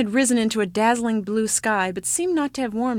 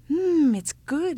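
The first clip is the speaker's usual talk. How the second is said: savoring; 7 semitones higher, slower than usual. Acted. Child tasting mush.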